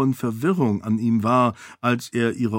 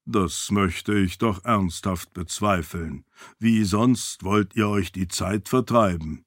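low servant voice; 4 semitones lower than usual. Acted. Servant asks his lady, low calm voice. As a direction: ask it low and measured.

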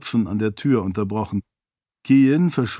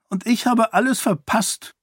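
put-on high-pitched childish sulk; sulky; 11 semitones higher than usual. A narrator's reading. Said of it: "meinte Kaise verdrossen". (verdrossen, kindlich schmollend)

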